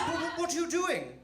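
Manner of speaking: high, stammering mum; fussing British mother. Retold or imitated. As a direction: question the child in a high, flustered voice. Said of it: "She said".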